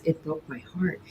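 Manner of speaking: soft, low, understated heartbreak; retold near tears. Store owner admitting how closing felt, quietly heartbroken. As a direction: confess it quietly, close to tears. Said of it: "she was so close to tears".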